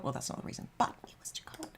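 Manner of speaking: a quick whispered joke under the breath; whispered aside. Real, not acted. Joking aside about leaving Sydney.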